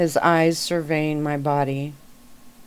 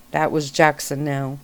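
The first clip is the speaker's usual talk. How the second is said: ordinary delivery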